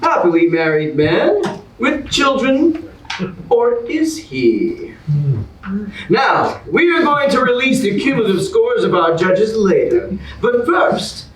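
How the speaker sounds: big, bright host announcement; game show host. Acted. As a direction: sell it like a TV host to the audience.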